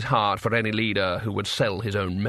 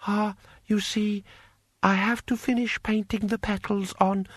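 apologetic eunuch; 9 semitones higher than usual. A narrator's reading. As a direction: make a meek excuse to your master. Eunuch makes excuses.

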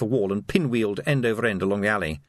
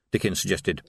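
ordinary delivery, nothing marked

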